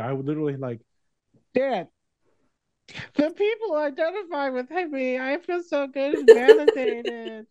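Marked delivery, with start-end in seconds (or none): 1.5-7.5 high, loud, drawn-out mock wail; over-the-top squeal to a parent; hammed-up gushing. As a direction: stretch it out, overcome with validation. Acting self gushing to father.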